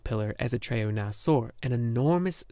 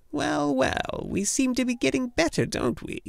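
much higher, fast, sing-song condescension; patronising gnome healer; 13 semitones higher than usual. A narrator's reading. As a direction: talk down to him like a nurse. Urgle's voice.

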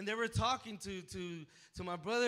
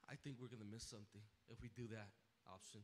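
hushed reply; 10 semitones lower, 15 dB quieter, faster than usual. Retold or imitated. Quiet quoted reply.

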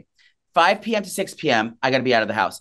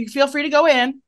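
lifted, overly sweet invitation; chirpy welcome to a stranger on camera; sing-song host voice; 9 semitones higher than usual. Retold or imitated. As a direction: play the gracious host through the doorbell cam. Greeting visitor over ring cam.

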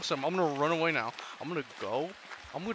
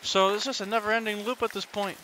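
normal talking voice

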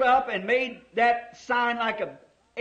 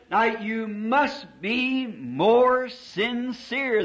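slow voice from above; much slower than usual. Retold or imitated. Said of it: "that voice said".

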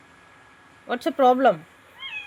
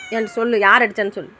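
usual speaking voice

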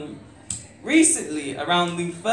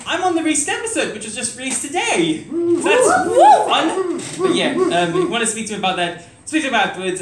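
high, fast, giggly rush; excitedly plugging the podcast; nervous open mic poet; 10 semitones higher, 6 dB louder than usual. Real, not acted. Giggly plug after performing.

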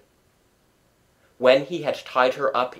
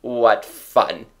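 doubtful; 5 dB louder than usual. A narrator's reading. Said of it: "he said doubtfully".

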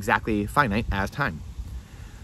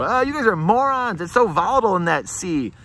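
mocking yell; 9 semitones higher, 4 dB louder than usual. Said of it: "they're yelling back at you".

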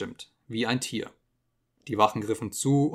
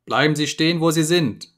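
loud, sharp order from a hidden speaker; cold loud command from the telescreen; 5 semitones higher, 8 dB louder than usual. Acted. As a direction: bark the command down into the cell. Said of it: "sagte die Stimme".

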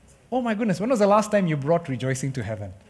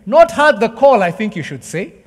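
emphatic quote; 4 semitones higher, 7 dB louder than usual. Retold or imitated. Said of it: "He says".

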